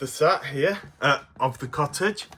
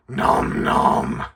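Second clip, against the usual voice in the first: munching creature voice; 7 semitones lower than usual. Acted. Puppet Stripe answers.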